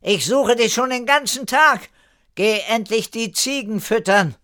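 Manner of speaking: scolding call. A narrator's reading. Erika orders Kire to feed the goats, calling out. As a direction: shout the chore over to someone. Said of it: "rief Erika beim Weggehen und ergänzte".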